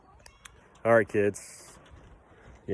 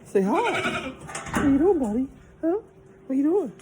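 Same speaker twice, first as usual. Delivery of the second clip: high pet voice. Real, not acted. Greeting the farm cats, in a high cooing pet voice. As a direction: coo up high like talking to a kitten.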